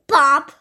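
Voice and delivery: loud bop sound effect. Acted. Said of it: "she was like".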